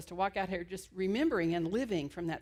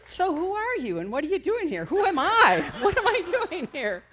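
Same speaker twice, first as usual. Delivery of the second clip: laughing; 9 semitones higher, 10 dB louder than usual. Retold or imitated. Punchline, speaker laughing.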